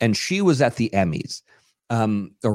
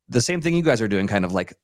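normal talking voice

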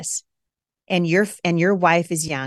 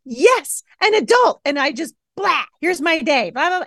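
much higher, eager gushing; giddy excitement; 10 semitones higher, 4 dB louder than usual. Retold or imitated. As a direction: burst out, thrilled to see an adult. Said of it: "and I'm like".

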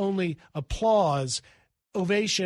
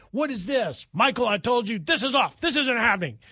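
higher, louder retold irritation; mock annoyed boss brushing off the interview; half-acted exasperated refusal; Donald Trump irritated; 5 semitones higher, 4 dB louder than usual. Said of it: "He walks in and says".

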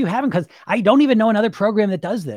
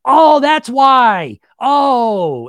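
loud, drawn-out "aha" of discovery, higher and slow; sudden realization; 7 semitones higher, 9 dB louder, much slower than usual. Retold or imitated. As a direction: stretch out the "Oh" like a breakthrough just hit. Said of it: "and you're like".

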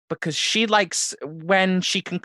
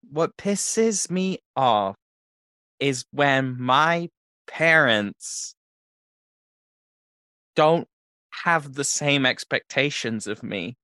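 very slow, halting; halting confession; 3 semitones lower, much slower than usual. Acted. Son slowly confessing to the hired guy. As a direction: drag it out, hesitant. Kid finally confessing feelings.

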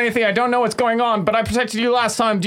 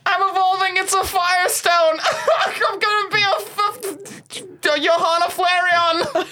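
excited shout; 7 semitones higher than usual. Acted.

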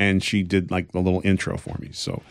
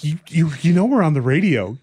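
ordinary talk, nothing marked

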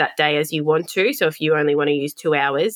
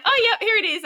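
chirpy staff voice; faster than usual. Retold or imitated. Retold staff answering phone.

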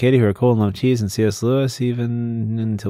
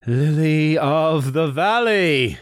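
slow, high, drawn-out declaiming; classical stage acting; 5 semitones higher, much slower than usual. Shown as a demonstration. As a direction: savor it like a stage actor. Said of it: "a Shakespearean thing of awesomeness".

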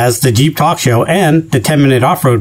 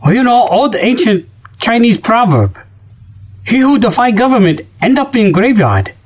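proverb in a hammed-up Chinese accent, higher; mock Chinese accent; 6 semitones higher than usual. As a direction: recite a fake proverb in a put-on Chinese accent.

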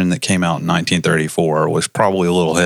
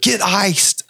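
mock high callout; 13 semitones higher than usual. Joking callout.

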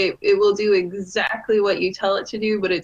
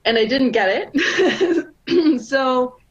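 hoarse, strained voice after a week of shouting; 5 semitones higher, slower than usual. Real, not acted. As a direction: voice worn rough from yelling. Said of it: "I'm losing my voice from yelling".

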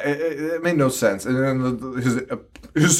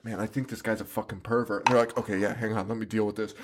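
calm mock normal talk; 5 semitones lower, 10 dB quieter, faster than usual. Contrasting calm normal dialogue.

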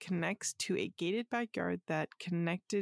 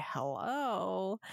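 sassy smoker voice. Retold or imitated. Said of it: "in the raspiest smoker voice a super sassy".

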